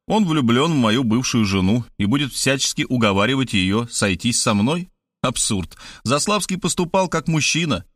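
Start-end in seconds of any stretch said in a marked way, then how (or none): none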